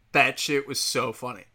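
ordinary talk

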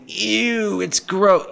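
high, whiny grossed-out protest; mock disgust. Mimics listeners' disgusted objection.

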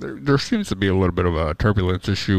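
low, calm pilot over the intercom; deadpan captain addressing passengers; airline captain announcement. Pretend. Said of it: "ladies and gentlemen, this is your captain speaking".

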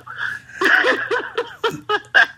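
long high peal of giggles; high giggling laugh. Real, not acted. Wrestler ducking a match with Persia, high giggling laugh. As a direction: burst out laughing at your own refusal.

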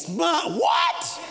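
slow, sarcastic disbelief; hammed-up parent scoffing; mock outrage. Parent mocks the kid.

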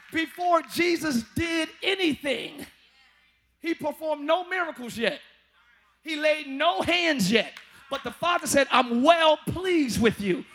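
loud, slow preaching build; full-force sermon climax. Acted.

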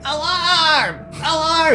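high piercing alarm cry; shouting alarm. Retold or imitated. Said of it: "Felix's voice shouting".

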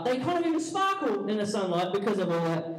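ordinary delivery

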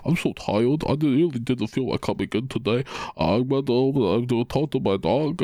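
sleepy, complaining coworker voice; imitating a tired coworker whining about coming in; Dumpy impression. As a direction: play a groggy slacker moaning he's tired. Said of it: "When you talk as Dumpy".

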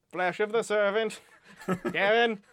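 high, loud, drawn-out villain quote; Wormtail voice. Acted. Reciting the ritual to co-host, hammed-up Wormtail voice. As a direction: declaim it high and slow, hammed-up. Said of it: "It's Wormtail's voice".

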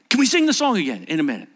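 high, loud interruption; acted kids calling out. Acts out campfire kids calling out.

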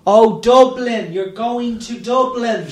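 slow, high-pitched, over-pronounced mum; exaggerated sing-out to foreigners; mum addressing foreign students. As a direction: repeat it back slowly for a learner. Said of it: "the way she talks to the Spanish students".

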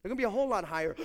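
normal talking voice

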